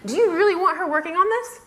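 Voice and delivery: high, loud, laughing disbelief; incredulous. Retold or imitated. Blogger questioning why nobody at Federated warned them, incredulous. Her stunned, incredulous reaction retold.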